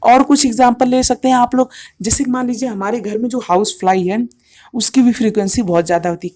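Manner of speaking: normal talking voice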